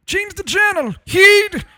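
very high, loud Scottish yelling dad; Scottish accent. Retold or imitated. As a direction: yell it like an angry Scottish dad. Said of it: "In a Scottish accent".

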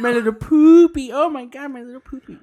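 put-on high baby talk to a dog; gushing over a little chihuahua; doting pet talk. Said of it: "I don't talk to him that way".

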